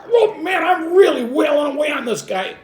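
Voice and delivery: very high, loud, pumped-up; excited self-talk. Retold or imitated. Rescuer pumping the chest, thrilled with himself, high loud excitement. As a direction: crow it like you're a hero.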